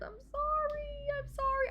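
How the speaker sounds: a high pleading sorry; whiny apology. Real, not acted. Apologizing to upset character.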